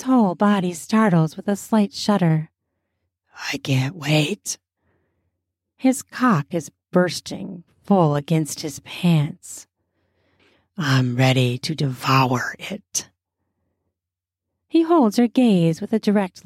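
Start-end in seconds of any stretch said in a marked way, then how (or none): 3.5-4.6 low breathless whisper. A narrator's reading. Aroused man whispers. 10.8-13.1 deep, low lustful voice; low hungry growl. A narrator's reading. Aroused man, low voice.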